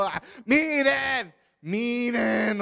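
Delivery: healed man pleading with the Pharisees, slow desperate cry. Acted. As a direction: drag it out like a man at his wits' end. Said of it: "o desesperado".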